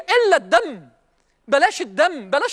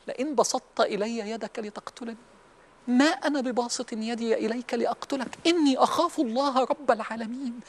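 Quran quotation; 7 dB quieter than usual. Acted. Recites the Quranic verse.

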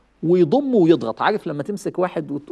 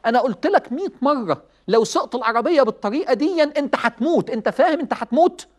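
raised, pressing lecture to a reckless son; stern parent scolding; 7 semitones higher than usual. Acted. Father warning his son he'll die driving, stern scolding. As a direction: drive the warning home like a worried father.

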